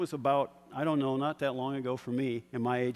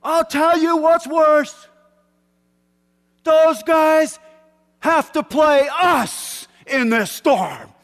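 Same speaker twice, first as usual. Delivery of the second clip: very loud and high, slow, every word hammered; coach's triumphant shout; 15 dB louder, much slower than usual. Retold or imitated. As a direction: turn the storm into a weapon. Coach yelling pep talk.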